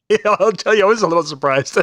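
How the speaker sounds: amused, laughing as you admit it. Real, not acted. Laughs over the surprise cash offer.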